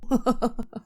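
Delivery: short soft chuckle; a quiet amused laugh off to the side; a brief hushed laugh. Acted. Lover laughs at Noha's joke.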